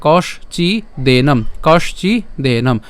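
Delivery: কষচিদেনম very slowly as "kosh-chi, de-nom"; slow breakdown. Shown as a demonstration. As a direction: pause between the parts of কষচিদেনম. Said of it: "তাও আমি একটু দেখিয়ে দিই". (কষচিদেনম খুব ধীরে ভেঙে)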